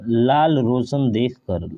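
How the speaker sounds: stretched-out slow reading of लाल रोशन देखकर; slow wrong reading. Shown as a demonstration. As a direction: drag the wrong phrase out slowly. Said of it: "लाल रोशन नहीं होता है".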